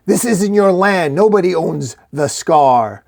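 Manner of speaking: high, loud territorial challenge; screaming. A narrator's reading. Red orc disputing the shield's claim, screaming. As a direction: shout the intruder down. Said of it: "The red orc screaming".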